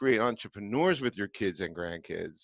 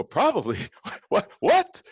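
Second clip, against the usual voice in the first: high, loud shocked disbelief; incredulous shout; 12 semitones higher, 8 dB louder than usual. Retold or imitated. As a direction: repeat it back in stunned outrage. Disbelief at Billy's answer.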